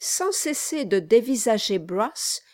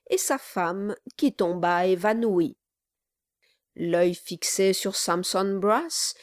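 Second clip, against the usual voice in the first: ordinary delivery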